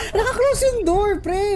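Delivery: high, shrieking laughter; panicked laughing. Real, not acted. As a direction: laugh in a breaking scream. Caught by hunter, panic.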